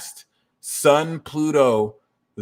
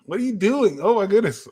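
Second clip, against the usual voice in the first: surprised; 7 semitones higher, faster than usual. Real, not acted. Interrupted, reacts off-topic.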